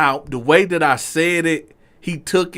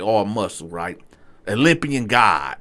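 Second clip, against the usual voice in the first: usual speaking voice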